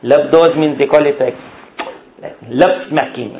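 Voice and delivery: ordinary delivery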